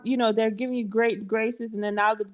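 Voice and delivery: ordinary talk, nothing marked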